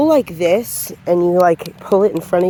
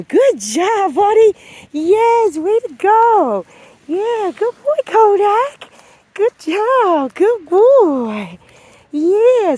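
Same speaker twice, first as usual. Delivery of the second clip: much higher, louder gushing praise; over-excited dog praise; 13 semitones higher, 6 dB louder, much slower than usual. Shown as a demonstration. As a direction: hype the dog up with squealing praise. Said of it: "Now, if I go".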